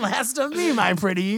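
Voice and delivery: high cackling witch threat. Pretend. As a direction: taunt like a storybook witch. Finishing the villain threat.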